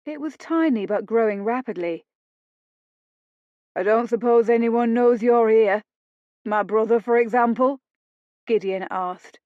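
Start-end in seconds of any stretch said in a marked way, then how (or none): 3.8-7.8 sneering. Acted. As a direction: sneer the question at her. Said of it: "a sneer in his voice".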